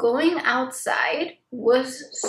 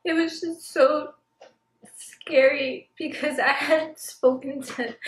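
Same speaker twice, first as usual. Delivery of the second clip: tearful; 4 semitones higher than usual. Real, not acted. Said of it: "don't know why I'm crying".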